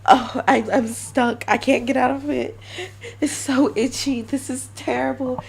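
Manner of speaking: a lightly voiced itchy lizard girl; stuck lizard girl. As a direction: act a lizard girl stuck in her shed, complaining. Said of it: "will be like".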